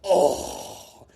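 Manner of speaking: a whispered, sinking "Oh."; dismayed breathy oh. Retold or imitated. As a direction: let the rep's heart drop on one breath. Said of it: "I could hear him ... he goes".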